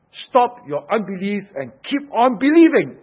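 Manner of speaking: loud, high exhortation, pushing through to the end; forceful command. Acted. Preacher voices the Lord correcting Thomas, forceful command.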